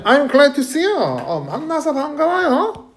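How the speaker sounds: high-pitched, acted delight; glad greeting. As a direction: greet someone as if pleased to meet them. (반가운 척 높은 목소리로)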